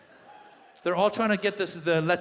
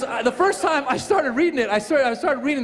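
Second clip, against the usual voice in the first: ordinary delivery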